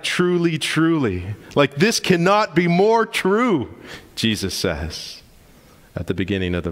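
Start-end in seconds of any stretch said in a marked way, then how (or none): 0.0-1.3 TRULY, TRULY said high and emphatically, the first higher; emphatic. Retold or imitated. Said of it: "he gives the emphatic". 1.8-3.7 slow, high, loud insistence on "cannot be more true"; "This cannot be more true" hammered home, high and slow; emphatic. Retold or imitated. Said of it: "he gives the emphatic".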